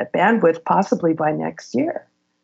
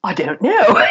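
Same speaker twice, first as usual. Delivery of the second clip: laughing; 2 semitones higher, 4 dB louder than usual. Retold or imitated. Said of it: "And he's like".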